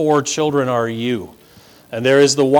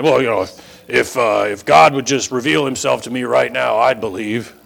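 online skeptic; 3 semitones lower than usual. Retold or imitated. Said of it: "they like to say online".

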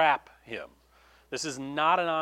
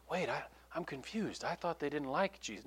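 soft, puzzled mutter; confused aside; 10 dB quieter than usual. Retold or imitated. Bystander tugging a shirt, softly confused. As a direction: mimic a confused bystander quietly. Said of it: "tugging on somebody's shirt going".

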